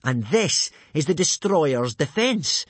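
loud, slow, high announcement; grandly drawn-out boast; showing off. Acted. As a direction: flourish the move's name. Snotlout shows off sword moves.

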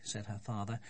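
plain reading voice

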